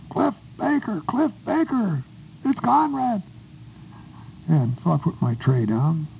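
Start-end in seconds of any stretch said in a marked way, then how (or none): 0.1-3.2 high-pitched holler across the mess hall. Retold or imitated. Conrad hollering to Cliff from behind the cake line, high call. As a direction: call out to a friend spotted in the line. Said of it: "he hollered at me".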